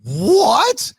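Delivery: high, loud, disbelieving; an outraged, incredulous what; stunned what. Real, not acted. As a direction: react in total disbelief. Disbelief at the story.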